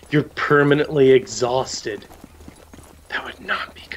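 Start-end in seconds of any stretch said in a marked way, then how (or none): 3.1-4.0 whisper. Real, not acted. Whispered joke.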